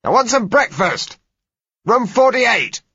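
loud, high shout down the passage; calling out down a hospital corridor. A narrator's reading. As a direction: yell hey to get someone's attention. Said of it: "I shouted".

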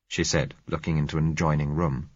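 plain reading voice

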